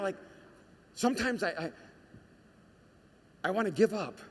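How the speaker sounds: usual speaking voice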